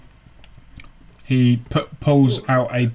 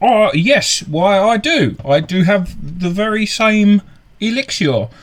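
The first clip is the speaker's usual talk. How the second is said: eager merchant; 9 semitones higher than usual. Acted. Scene: the merchant cheerfully answers the insulting question, high and eager. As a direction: seize the insult as a sale, pitch jumping up.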